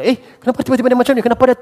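quick, high puzzled question; surprised. Retold or imitated. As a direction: mimic a startled churchgoer. Surprised friend at church.

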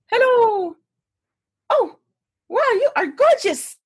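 high-pitched tipsy Swedish lady; Swedish accent. Retold or imitated. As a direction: gush in a hammed-up Swedish accent. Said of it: "try to do a Swedish accent".

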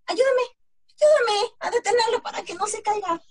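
loud, high, urgent plea for help; calling for help. Acted. Tlacuache begs the coyote for help, loud, high plea. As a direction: cry out for help as the hill seems to fall.

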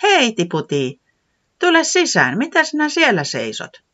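high friendly call to the door; Säämies calling. Acted. Säämies calling Tiputi in from the doorway, high and friendly. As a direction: call the little visitor in, voice lifted high.

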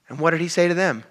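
normal talking voice

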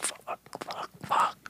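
whispered curses under the breath; panicked whisper. Retold or imitated. Scared man whispering to himself. Panicked inner voice in scary spot.

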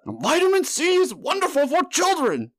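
put-on very high, off-key politician accent; hammed-up campaign rant sounding like Count Dracula; Bernie Sanders impression. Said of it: "he's trying to do a Bernie accent".

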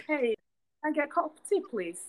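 a light British accent, ordering tea. Shown as a demonstration. Ordering tea at a café to show off her new lessons, in a light British accent. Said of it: "start taking classes officially for".

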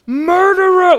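high, loud cry of "Murderer!"; yelled accusation. Retold or imitated. Jonah clutching the dead plant, yelling at God. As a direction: hurl the word at God like a betrayed lover. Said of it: "he yells".

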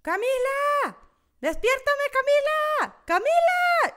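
high, loud desperate calling. Retold or imitated. Calling her sister for help during sleep paralysis, loud high pleading. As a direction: shout for the sister to wake you.

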